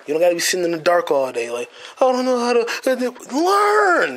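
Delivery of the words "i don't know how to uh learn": high whiny mock of a helpless person. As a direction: whine it like someone hopeless.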